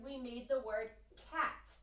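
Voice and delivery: ordinary delivery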